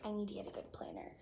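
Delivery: quick hushed whisper of a resolution; fast whispered thought to herself; whispered inner thought. Retold or imitated. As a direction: whisper it like a private promise. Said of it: "but I was like".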